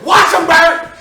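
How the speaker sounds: loud, high warning cry; shouted warning. Acted. Bystander warns the policeman about the man, shouting. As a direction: shout a sudden alert.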